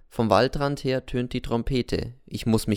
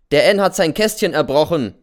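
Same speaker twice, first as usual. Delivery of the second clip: loud, higher call across the camp; sergeant calling out; 5 semitones higher, 8 dB louder than usual. Acted. Sergeant calls across the camp to the teacher, loud and high. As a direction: shout the accusation over to the teacher. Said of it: "ruft mir der Feldwebel zu".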